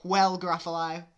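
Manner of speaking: high, loud mouse voice; cocky mouse. Acted. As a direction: pipe it up boldly. Mouse's line in the story.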